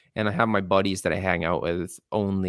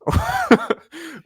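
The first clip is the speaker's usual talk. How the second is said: high burst of laughter; 6 dB louder than usual. Real, not acted. Big laugh at the joke.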